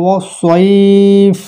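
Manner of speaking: slow recitation, stopping at the end; recited with a stop. Shown as a demonstration. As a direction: recite it slowly and stop on the last word. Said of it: "যদি আমি এখানে থামি". (থেমে ধীর তিলাওয়াত)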